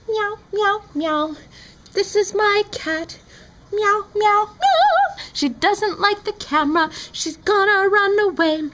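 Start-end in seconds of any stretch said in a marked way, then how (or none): 0.1-1.4 meowing. Pretend. Meowing at cat. 3.7-5.2 meowing. Pretend. Meowing at cat.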